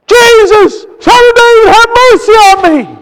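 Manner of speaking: blind man's desperate high shout; loud, high cry for mercy. Retold or imitated. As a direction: shout the plea at full voice. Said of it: "he cried out the louder".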